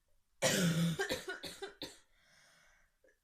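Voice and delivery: very loud real coughing fit; chest cough. Real, not acted. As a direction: cough hard, chest rattling. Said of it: "Excuse me".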